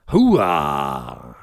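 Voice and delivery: loud, drawn-out catchphrase, dropping low; Al Pacino. Acted. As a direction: belt it out in a hammed-up movie-star impression. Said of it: "You did Al Pacino".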